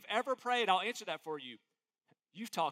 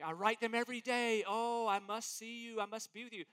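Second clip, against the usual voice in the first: hammed-up pleading admirer, slowing down; a hint of swooning plea; mock lovesick fan; 3 semitones higher than usual. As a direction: play the desperate obsessed fan. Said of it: "You're like".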